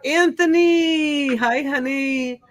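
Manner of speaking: high, slow, loud greeting call. Real, not acted. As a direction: sing his name out in welcome. Greeting a viewer arriving in chat.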